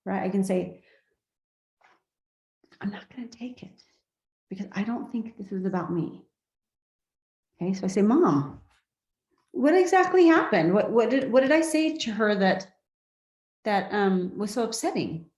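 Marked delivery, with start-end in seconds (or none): 2.8-6.2 a soft unvoiced inner decision; whispered refusal. Shown as a demonstration. Daughter quietly refusing her mother's blame, whispered. 8.0-15.3 high, gently inquiring questions; very curious. Shown as a demonstration. Daughter asking her mother what happened, curious and open. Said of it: "see how I'm being very curious".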